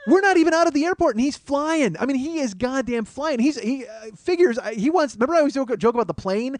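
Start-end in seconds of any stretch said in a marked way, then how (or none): 0.1-5.3 much higher and louder, laughing; frantic disbelief. Real, not acted. Comic telling the crowd about the wild taxi, near shouting. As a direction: build to an incredulous peak. Climax of frantic cab story.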